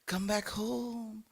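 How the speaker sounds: high, slow, sweet call; luring voice. Retold or imitated. Radio voice luring soldiers home, slow high croon. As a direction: croon it like a siren. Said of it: "playing this to the Japanese soldiers".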